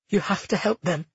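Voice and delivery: plead, struggling to get it out; barely able to speak. A narrator's reading. Cleo begs Lucia to help the trapped, barely speaking. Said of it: "Cleo barely managed to speak".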